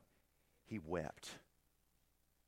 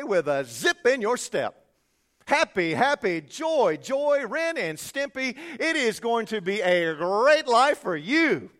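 much higher, louder, slow hammed-up hype preacher; cheesy preacher; 8 semitones higher, 12 dB louder, slower than usual. Mocking other preachers to the congregation, hammed-up hype. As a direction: ham it up like a slick TV preacher. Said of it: "they'll say".

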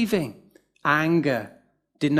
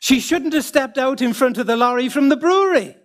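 higher, louder Northern Irish lilt, deadpan; hint of Northern Irish accent, higher and louder; Northern Irish chap; 8 semitones higher, 5 dB louder than usual. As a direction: deliver the punchline like the mischievous Irishman. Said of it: "he says to me".

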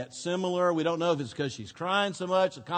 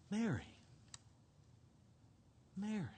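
tender call; 16 dB quieter, faster than usual. Acted. Jesus tenderly calling her name.

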